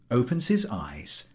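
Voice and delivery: ordinary delivery, nothing marked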